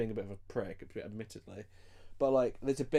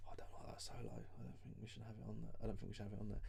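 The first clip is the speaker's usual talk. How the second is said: very soft, half-whispered imitation; hushed conferring; 2 semitones lower, 14 dB quieter, faster than usual. Podcaster voicing drummer and producer conferring about the solo, in a hushed murmur. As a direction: murmur doubts under your breath. Band members quietly confer in retelling.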